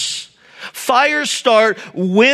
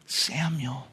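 much softer and lower, a gentle calling of the name; tender call; 8 semitones lower, 13 dB quieter than usual. Acted. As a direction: call "Samuel" softly, like waking a child. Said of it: "God's voice is tender".

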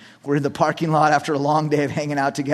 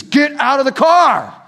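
loud, slow, high shouted order; commanding; 10 semitones higher, 8 dB louder, slower than usual. Retold or imitated. Retold cop ordering driver out, commanding shout. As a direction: shout it slowly as an absolute command. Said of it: "he was commanding".

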